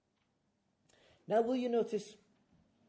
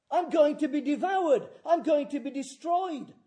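acted alarm; 12 semitones higher, 16 dB louder than usual. Voices someone fleeing a lion.

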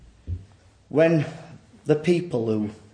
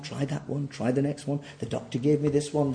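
ordinary talk

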